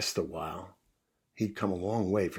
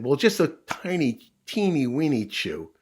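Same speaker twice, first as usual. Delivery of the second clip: high, loud, playful stretch on the small words; sheepish puppy admission; 6 semitones higher, 6 dB louder than usual. Acted. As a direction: own up like a cheeky puppy. Puppy sheepishly admits a small misdeed.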